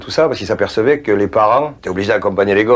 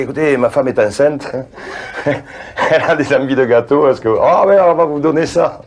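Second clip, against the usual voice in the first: laughing fib; 6 semitones higher than usual. Retold or imitated. Phoning bakers with a fib.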